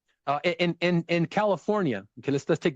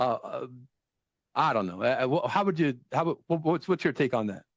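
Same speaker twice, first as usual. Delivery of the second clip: usual speaking voice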